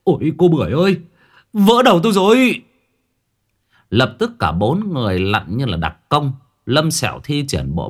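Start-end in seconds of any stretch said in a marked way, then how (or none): 0.0-2.6 high, loud pained shriek; howl of pain. A narrator's reading. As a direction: cry out as the coconut hits. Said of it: "Cậu rú lên". (rú lên đau đớn)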